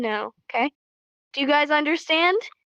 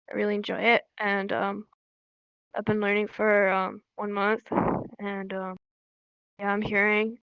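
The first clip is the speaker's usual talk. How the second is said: low, soft, halting monotone; mock awkward beginner with long pauses; stiff, robot-like; 4 semitones lower, 5 dB quieter than usual. Shown as a demonstration. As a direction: introduce yourself stiffly, zero energy. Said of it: "You're like a robot".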